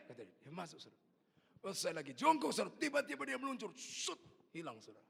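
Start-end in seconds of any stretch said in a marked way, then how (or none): none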